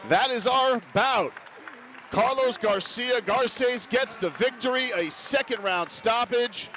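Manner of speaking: high, slow, shouted result call; excited fight call. Real, not acted. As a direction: belt out the winner like a ringside caller. Commentator calls the fight result.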